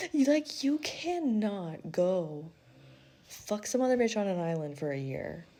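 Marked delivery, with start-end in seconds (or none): none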